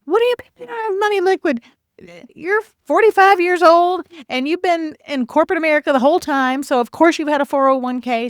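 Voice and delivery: high-pitched, incredulous pushback; retold exasperation, pitched well up; exasperated retort. Retelling her bothered retort to people.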